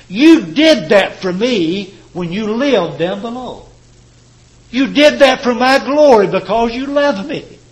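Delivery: fervent sermon climax; preaching at full force. Acted. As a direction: lift the congregation toward the throne.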